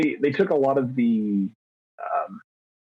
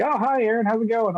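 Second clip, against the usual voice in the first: mock coworkers greeting him, pitched much higher; a bright, higher sing-song hello; chipper coworker greeting; 9 semitones higher, faster than usual. Coworkers greeting returning Aaron, in a mock chipper voice. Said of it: "everyone's like".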